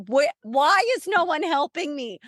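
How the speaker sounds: imitating her own yelling at the staff; retold screaming. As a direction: replay shouting out of the room. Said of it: "I'm screaming out of the room".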